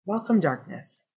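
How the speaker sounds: unhurried meditation voice, long pauses between phrases; guided meditation. Real, not acted. Teacher guiding listeners with closed eyes into breath, calm meditation voice.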